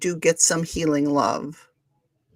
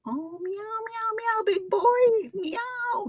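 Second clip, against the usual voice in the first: very high soft meowing; 4 dB quieter, much slower than usual. Real, not acted. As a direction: meow sweetly at the cat. Meows at the cat.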